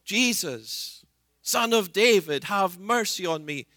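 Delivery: a calling plea for mercy, not yet full force; crying out. Acted. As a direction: call out to Jesus, begging. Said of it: "so he cries out".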